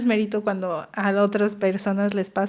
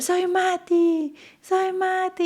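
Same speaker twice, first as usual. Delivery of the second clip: much higher, slow, drawn-out mock yell; retold excited shout; 10 semitones higher, much slower than usual. Mother imitating her son shouting his name, excited. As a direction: stretch it out high like a thrilled child. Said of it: "y empezó a gritar".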